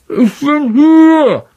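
put-on high boyish voice, slow, from behind rock; Ron, muffled. A narrator's reading. Ron behind the rockfall answering Harry: muffled boy's voice. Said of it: "eine gedämpfte Stimme hinter dem Felseinbruch".

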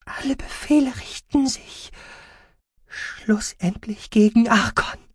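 high, halting, slow voice; airy, strained high voice; frail patient. Acted. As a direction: labour through it weakly. Weak patient recalls dark orders.

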